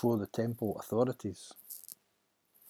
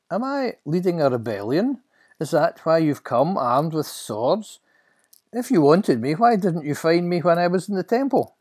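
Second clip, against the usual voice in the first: louder, higher challenging questions; Jesus, indignant; 8 semitones higher, 8 dB louder than usual. Acted. Jesus to the armed guards arresting him, indignant.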